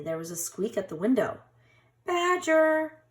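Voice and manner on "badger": small high-pitched mouse voice, louder; high squeaky mouse. A narrator's reading. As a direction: call out like an anxious little mouse. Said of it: "cried Mouse".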